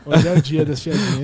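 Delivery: loud, talking through laughter; laughing. Real, not acted. Guest reacting to the host's little joke, laughing. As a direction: let the laugh spill into the words.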